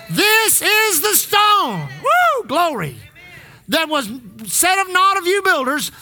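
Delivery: full-force preaching climax; preaching shout. Acted. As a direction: lift it up and shout it to the church.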